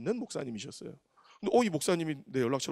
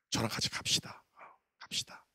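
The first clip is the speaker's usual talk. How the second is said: whispered; 6 semitones lower, 7 dB quieter than usual. Retold or imitated. Quiet call to the staff.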